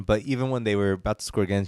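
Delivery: ordinary talk, nothing marked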